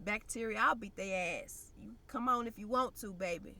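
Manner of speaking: normal talking voice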